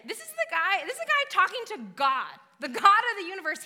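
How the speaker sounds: fervent preacher building up; emphatic preaching. Real, not acted. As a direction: drive it home with rising force. Preacher stressing God's greatness.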